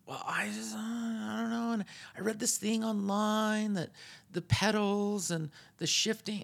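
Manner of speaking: mock the indecisive friend; high unsure mumbling beginner; whiny hesitant buyer.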